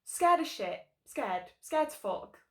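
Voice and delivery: normal talking voice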